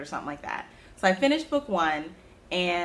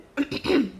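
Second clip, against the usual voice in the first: sharp clearing of the throat; 8 semitones higher, 4 dB louder than usual. Real, not acted. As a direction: clear your throat hard. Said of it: "excuse me, I'm choking".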